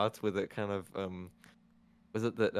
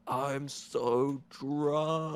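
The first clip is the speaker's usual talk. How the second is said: higher, very drawn-out drunk impression; mock drunk; 5 semitones higher, much slower than usual. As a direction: stretch it out like an overacted drunk. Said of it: "I love her drunk acting".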